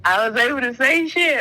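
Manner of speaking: ordinary talk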